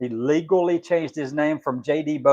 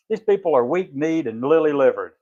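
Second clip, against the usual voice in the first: ordinary talk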